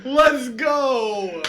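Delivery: high, drawn-out celebratory cry; excited cheer. Real, not acted. Player celebrates a natural twenty, excited. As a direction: cheer with laughter in it.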